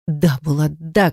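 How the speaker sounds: ordinary delivery, nothing marked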